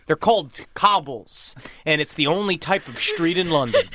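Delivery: ordinary delivery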